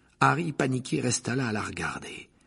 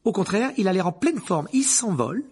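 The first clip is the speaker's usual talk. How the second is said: higher, louder, brightening up; boy contradicting his teacher, higher and louder; eager retort; 10 semitones higher, 6 dB louder than usual. A narrator's reading. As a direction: insist it's fine, with a burst of energy. Harry invents cheerfully.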